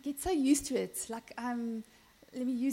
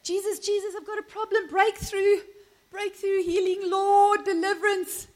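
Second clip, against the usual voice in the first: high, pleading mock prayer; desperate prayer; 7 semitones higher, 5 dB louder than usual. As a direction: cry out for a breakthrough, hammed-up. Mimics our desperate way of praying.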